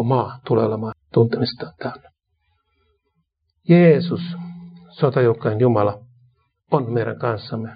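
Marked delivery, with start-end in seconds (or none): 3.7-4.2 a sudden loud high cry of the name; Jesus called out with force; proclaimed name. Real, not acted. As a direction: ring the name out to the hall.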